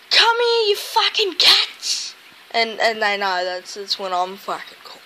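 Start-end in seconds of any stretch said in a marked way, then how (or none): 0.1-2.1 high, loud mock yell, drawn out; yelling at the cats. Retelling how he yells at his cats, loud and high. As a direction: call the cats in a big exasperated yell. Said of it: "And I'm like".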